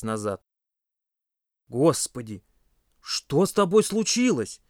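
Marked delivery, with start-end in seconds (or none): 1.7-4.6 shocked exclamation. A narrator's reading. A friend sees Pasha's smeared face, exclaiming in shock. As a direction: pitch climbing into drawn-out alarm. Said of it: "воскликнул кто-то".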